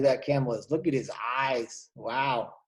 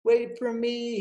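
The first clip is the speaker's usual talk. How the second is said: much higher plea; calling after; 9 semitones higher than usual. Acted. Blackbird left behind calling the swallows, high plea.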